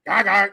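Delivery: loud mock cackle; short harsh burst of fake cackling; doll cackle. Noisy doll interrupting parent, loud mock cackle.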